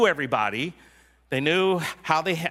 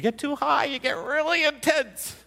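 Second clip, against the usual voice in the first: much higher, strained and intense; too high, intense; 12 semitones higher than usual. Shown as a demonstration. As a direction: show how a raised voice sounds. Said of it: "Lower your voice".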